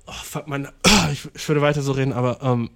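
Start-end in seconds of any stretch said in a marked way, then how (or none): none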